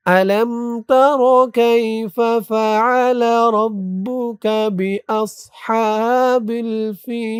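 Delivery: slow, drawn-out chanted recitation; Quran recitation. Shown as a demonstration. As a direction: recite the verse slowly and melodically. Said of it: "আমার সাথে বলুন". (ধীরে সুরেলা কুরআন তিলাওয়াত)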